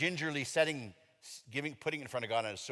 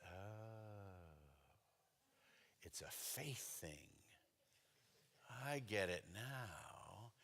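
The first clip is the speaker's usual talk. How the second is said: much softer, slow dawning understanding; soft realization; 5 semitones lower, 17 dB quieter, much slower than usual. Retold or imitated. Realizing in prayer that it's about faith, soft and slow. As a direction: let it sink in slowly, almost to himself.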